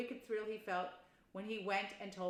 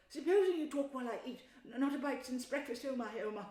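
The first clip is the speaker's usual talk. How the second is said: Mole voice; 5 semitones higher, 4 dB louder than usual. Acted. Mole hungry at table.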